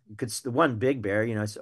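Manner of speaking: usual speaking voice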